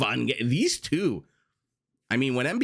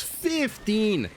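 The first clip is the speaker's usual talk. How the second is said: excited; 12 semitones higher than usual. Real, not acted. Caster hypes tower range.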